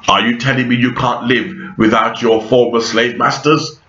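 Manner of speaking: ordinary talk, nothing marked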